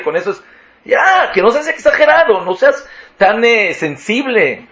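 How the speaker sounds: high, exasperated excuses; indignant self-justification. Acted. Speaker accused of talking ugly makes excuses, indignant and exasperated. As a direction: wave off the complaint, high-pitched and defensive.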